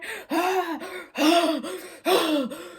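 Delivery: loud repeated hammed-up gasps; meltdown gasps. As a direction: heave breaths in a mock fit. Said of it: "She's like".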